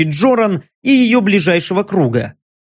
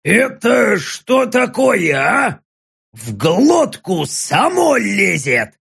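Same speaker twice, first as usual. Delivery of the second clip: surprised orc; 2 semitones higher, much slower than usual. Acted. Орк изумлён вкусом рассола.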